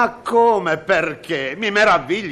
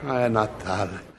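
soft echo; 6 semitones lower, 7 dB quieter than usual. Acted. Cameraman echoing the director, soft and mock-sentimental. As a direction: echo it back softer and lower.

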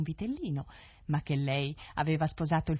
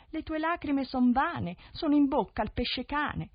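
voice from the sea; 9 semitones higher, 4 dB louder than usual. Acted. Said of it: "una voce che diceva".

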